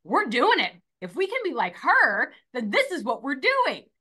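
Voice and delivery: put-on fired-up kids. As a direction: declare it like kids all fired up. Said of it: "I got them fired up".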